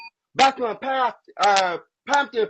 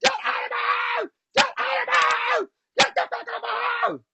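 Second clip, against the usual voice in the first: mock hysterical screech, much higher; shrill screaming imitation. Imitating Pumpkin screaming her out of the house, high shrill mock yell. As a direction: caricature her shrieking to get out. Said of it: "she was like".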